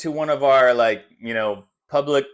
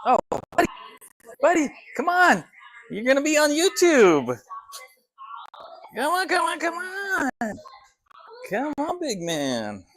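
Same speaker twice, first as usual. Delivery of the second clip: coaxing a dog; 10 semitones higher than usual. Real, not acted. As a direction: sweet-talk the dog to come.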